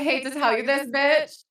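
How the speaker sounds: loud, playful jab; loud teasing. Real, not acted. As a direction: call it out teasingly. Teasing friend in voice chat.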